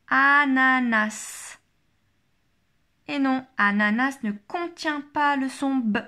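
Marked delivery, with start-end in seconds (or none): 0.1-1.6 very slow, syllable-by-syllable test word; drawn-out, careful dictation. Shown as a demonstration. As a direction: stretch each syllable out for the pupil. Teacher repeats test word.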